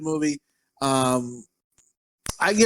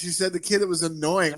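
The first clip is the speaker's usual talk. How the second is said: normal talking voice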